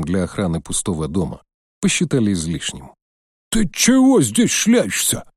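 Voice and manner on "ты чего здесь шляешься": put-on high, slow, hostile old man; angry old watchman. A narrator's reading. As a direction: bristle like a cranky old guard. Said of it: "вскипел было старик".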